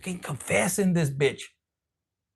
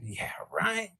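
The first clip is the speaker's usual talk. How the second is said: sarcastic whisper; 8 dB quieter than usual. Real, not acted. Sarcastic aside after quote.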